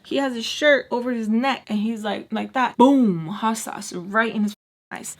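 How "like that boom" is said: ordinary delivery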